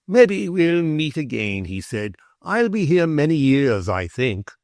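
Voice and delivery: lightly voiced steady young recruit; matter-of-fact youth. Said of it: "talk in an everyday voice".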